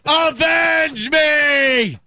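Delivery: hammed-up dying hero shout; high, slow heroic death cry; overacted death scene. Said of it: "Red Dawn".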